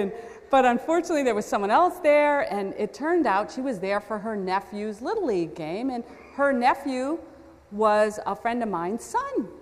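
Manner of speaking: ordinary talk, nothing marked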